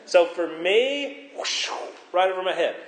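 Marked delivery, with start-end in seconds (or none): 1.4-1.7 breathy imitated whoosh; whoosh noise. Shown as a demonstration. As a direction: make a rushing whoosh of something flying past. Mimes words going over his head.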